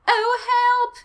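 very high, loud, drawn-out wail; hammed-up squeal of panic; cry for help. A narrator's reading. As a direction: play the victim in overdone distress. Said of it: "he managed to cry".

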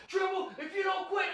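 lightly voiced menacing jock; imitating bully. Bully threatening.

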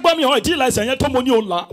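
high loud fast preacher command; shouting Yoruba pastor. Retold or imitated. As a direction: bark it like a fiery pastor.

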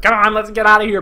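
imitated hero urging an escape; mock-urgent call to get moving; urgent acted rescue. Voicing another hero rushing to leave.

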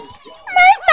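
very high squeak; squeaky toy voice. Pretend. Kid voicing toys, squeak.

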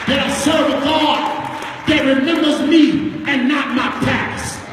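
sung, tuneful preacher's chant over the organ; rising sing-song sermon cadence, building the crowd; whooped preaching. Acted.

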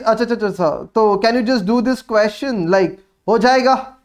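usual speaking voice

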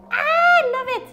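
usual speaking voice

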